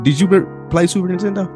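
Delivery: ordinary delivery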